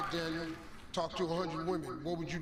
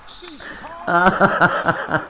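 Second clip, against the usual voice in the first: laughing; 17 dB louder, much slower than usual. Real, not acted. Host laughing, drawn out.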